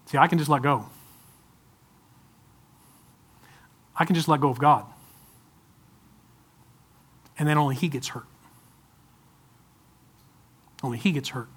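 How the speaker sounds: low, soft and lingering; hushed and somber; quiet musing. Acted. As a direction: let it sink in gently.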